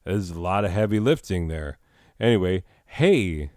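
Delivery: usual speaking voice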